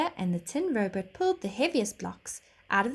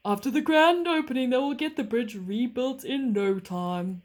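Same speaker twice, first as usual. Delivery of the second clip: train driver; 4 semitones higher, 5 dB louder than usual. A narrator's reading.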